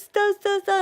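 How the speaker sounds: high, whiny imitation of someone crying; mock crying. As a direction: imitate her wailing stop in a high pitch. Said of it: "she starts crying".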